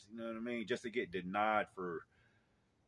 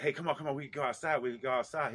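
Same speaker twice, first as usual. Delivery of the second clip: higher, louder hurried coaxing; raised-pitch mock urgency; retold urging; 5 semitones higher, 5 dB louder, faster than usual. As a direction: re-enact hustling a little kid along. Hurrying a child outside.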